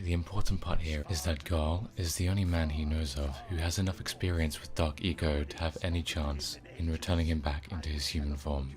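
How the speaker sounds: usual speaking voice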